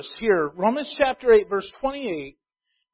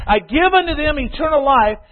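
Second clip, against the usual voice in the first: slow, loud emphatic reading; preaching scripture; 4 semitones higher, 6 dB louder, slower than usual. Acted. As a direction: pulpit proclamation, words stretched. Preacher reads corrected scripture forcefully.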